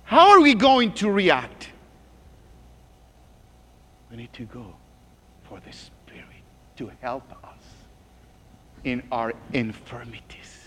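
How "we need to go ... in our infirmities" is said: hushed near-whisper, much softer. Real, not acted. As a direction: drop to a secret whisper for the flock. Preacher drops to intimate whisper.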